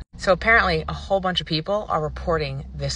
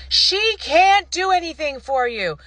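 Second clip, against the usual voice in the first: high, loud, drawn-out yell; stretched, high-pitched shout over someone's call; mock screaming; 11 semitones higher, 5 dB louder, slower than usual. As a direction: imitate someone screaming from across the room. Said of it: "is screaming in the background".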